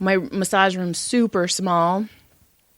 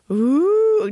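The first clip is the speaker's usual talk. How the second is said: ghost moan; 10 semitones higher than usual. Retold or imitated. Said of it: "I heard you go".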